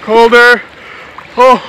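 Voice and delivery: loud gasping cold shock. Real, not acted. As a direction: yelp at the freezing water. Wading into cold lake.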